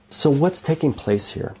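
ordinary talk

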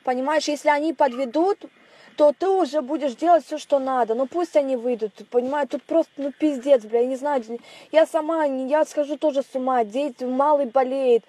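distant voice through a phone line; thin telephone sound from the other end; over a landline phone. Real, not acted. Wife heard over phone call. (голос по стационарному телефону)

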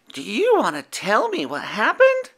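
high gentle werewolf; Howler's voice. A narrator's reading. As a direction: kind young werewolf greeting.

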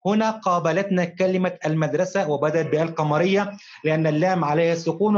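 ordinary talk

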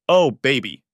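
loud, overcome exclamation; emotional outburst of realization; gasped. A narrator's reading. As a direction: gasp it out to your daughter. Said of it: "I gasp".

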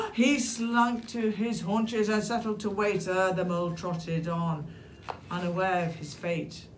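plain reading voice

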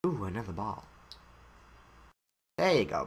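ordinary talk

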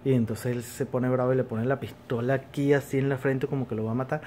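ordinary talk, nothing marked

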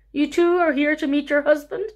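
high amused chuckle; laughing old man. Acted. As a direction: laugh through the question.

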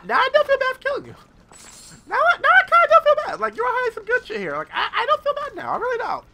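giddy laughing. Real, not acted. Player laughs that the loot excuses the kill. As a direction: giggle with guilt gone.

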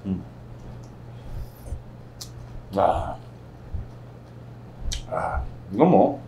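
chewing a big bite; talking through full cheeks; mouth full. Real, not acted. Seen 2: Cheeks puffed with the lettuce wrap he stuffed in just before.

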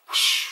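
loud rushing whoosh noise; imitated whoosh. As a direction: make a quick whoosh of air. Imitates the speed of progress.

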